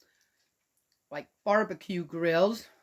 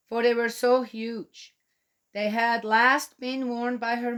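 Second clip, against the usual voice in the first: storybook reading, a touch higher and louder; story narration; 5 semitones higher, 4 dB louder than usual. Acted. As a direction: read the tale to the little ones. Reads the story aloud.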